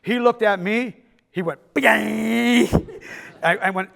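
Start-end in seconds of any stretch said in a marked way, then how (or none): 1.7-2.7 loud, high comic boing; long held cartoonish squeal of shock; imitated startled reaction, hammed up; shocked yelp noise.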